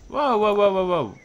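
loud, high string of whoas; alarmed. Real, not acted. Player seeing a problem pop up, alarmed. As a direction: call a sudden halt in alarm.

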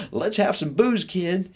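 high, loud mock tough guy; tough-guy boast. Boy imagines shocking grandmother, high tough-guy voice. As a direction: play the bad boy to shock her. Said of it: "he'd say".

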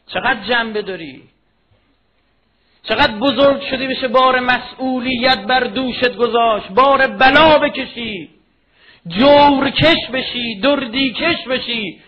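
very loud, high, drawn-out exhortation; full-force preaching. Real, not acted. Preacher challenges listeners to bear responsibility, full-force impassioned cry. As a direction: stretch each phrase into a soaring shout.

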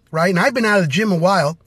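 normal talking voice

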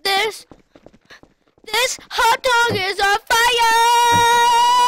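high, loud and slow, stretching out fire; alarmed shout. Real, not acted. Gamer yelling at his burning hot dog, loud and stretched. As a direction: yell it like the food's ablaze.

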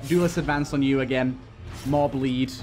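ordinary talk

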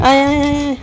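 high sing-song calling to a pet; calling a pet over to bathe. Real, not acted. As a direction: call the dog over on a lilting high note.

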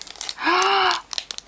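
long high gasp of delight. Real, not acted. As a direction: gasp at the owl charm. Gasp at owl charms.

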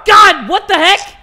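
very loud, high, drawn-out outburst; startled shout. Real, not acted. Player jolted while taking pills, startled shout. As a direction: yell out in sudden shock.

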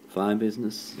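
ordinary delivery

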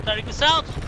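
calling out. Real, not acted. Warning teammate of enemy vehicle.

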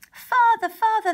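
high, loud calling out across the woods; shouting. Acted. Children shouting for their father in the forest, loud and high. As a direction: call out for a lost father. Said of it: "they shouted".

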